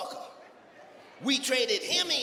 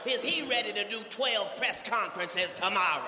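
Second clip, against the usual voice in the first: ordinary delivery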